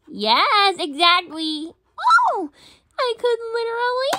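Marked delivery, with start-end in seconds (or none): none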